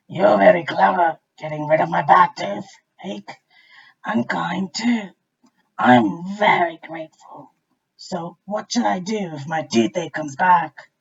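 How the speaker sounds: loud, slow booming voice; grateful crocodile. A narrator's reading. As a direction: rumble it like a thankful crocodile. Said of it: "he said to him".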